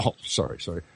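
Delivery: hushed, embarrassed apology; sheepish whisper. Retold or imitated. Guard apologizing for the rifle, whispered sheepishly. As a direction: mutter it like a caught-out guard.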